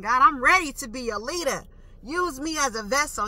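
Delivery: retold eager prayer. Believer telling God she is ready to lead, high eager retold plea. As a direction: call it up to God, pitched high.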